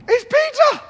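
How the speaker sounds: high, loud excited cry; excited news. Acted. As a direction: burst into the room with the news. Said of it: "she bursts in and go".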